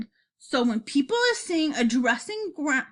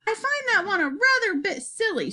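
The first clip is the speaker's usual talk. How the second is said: higher, lilting little critic; lightly voiced Sophie; 8 semitones higher than usual. A narrator's reading. Sophie to the BFG about the label, light high-pitched voice. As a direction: lightly voice a girl who finds it silly.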